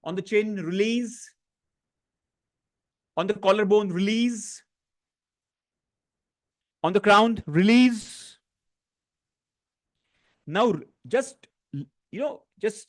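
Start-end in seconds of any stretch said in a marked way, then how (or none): 0.0-8.4 soothing guided release cues; guided tapping. Acted. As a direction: call each point calmly, then release. Leads tapping round.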